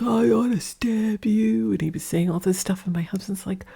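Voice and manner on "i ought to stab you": put-on drunk threat. Drunk neighbor threatening husband.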